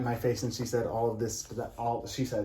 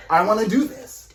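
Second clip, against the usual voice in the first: louder, higher defiant comeback; defiant shout; 11 semitones higher, 10 dB louder than usual. Retold or imitated. Answering his scene partner's challenge, raised and defiant. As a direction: throw it back at her, raised. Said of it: "I was like".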